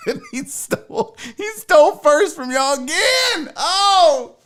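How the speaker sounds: high-pitched, amused exclaiming through laughter; laughing. Real, not acted. Host telling chat who stole first, laughing. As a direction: crack up while getting the words out.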